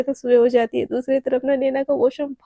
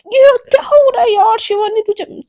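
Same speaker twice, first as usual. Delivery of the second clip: exasperated cry; 8 semitones higher, 8 dB louder than usual. Acted. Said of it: "उसका दिमाग ख़राब हो जाता है".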